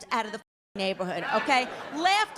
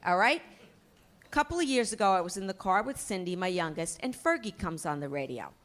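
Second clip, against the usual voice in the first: ordinary delivery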